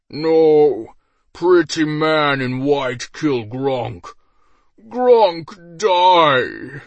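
slow, loud hammy ogre death. A narrator's reading. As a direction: ham up a dying brute. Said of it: "Gronk switched to his original voice".

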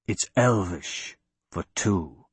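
low, hushed and slow in his ear; menacing whisper. A narrator's reading. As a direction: murmur it slowly, close to the ear. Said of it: "a voice whispered in his ear".

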